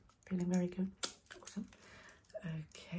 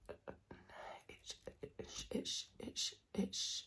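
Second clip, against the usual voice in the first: tingly asmr mouth sounds; 5 semitones lower, 8 dB quieter than usual. Acted. Stuttered whispered trigger sounds.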